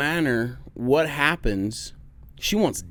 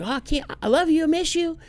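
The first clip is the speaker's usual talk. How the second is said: put-on gushing little girl; 14 semitones higher than usual. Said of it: "she's like".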